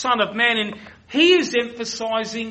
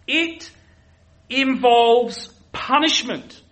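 emphatic preaching; 4 semitones higher, 6 dB louder than usual. Acted. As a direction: land the word punishment hard. Preaching on biblical justice.

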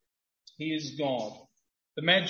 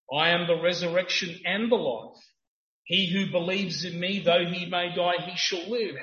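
rising, louder preaching cadence; 6 dB louder than usual. Acted. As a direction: proclaim it to the congregation. Preaching at full force.

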